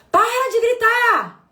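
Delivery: loud, high, slow yell; yelling parent. Retold or imitated. Mother imitating a parent yelling at a screaming child. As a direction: bellow it like an exasperated parent.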